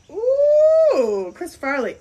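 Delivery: squealed high, loud ooh; thrilled fan squeal at meeting him; excited greeting. Retold or imitated. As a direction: model squealing at Chris Farley on the dance floor. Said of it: "and I was like".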